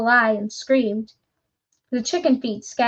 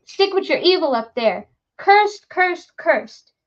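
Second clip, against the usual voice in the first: higher, louder frantic yell; screaming curses; 6 semitones higher, 4 dB louder, slower than usual. Acted. Terrified woodcutter screams curses at departing visitor. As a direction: scream the curse after him.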